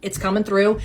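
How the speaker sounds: ordinary talk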